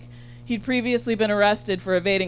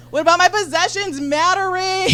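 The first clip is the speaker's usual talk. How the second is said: much higher, louder outraged demand; hammed-up indignation; indignant; 10 semitones higher, 5 dB louder than usual. Demanding her roommate respect her possessions, high and indignant. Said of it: "I'm like".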